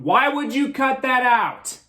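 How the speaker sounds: slow, exasperated question; why lingers and stretches out. Real, not acted. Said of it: "how many times I say that".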